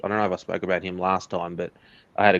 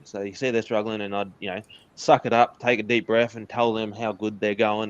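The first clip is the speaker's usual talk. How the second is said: ordinary talk, nothing marked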